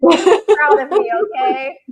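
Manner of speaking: slow, high and loud through a laugh. Real, not acted. Speaking to her dad about her name in print, laughing loudly.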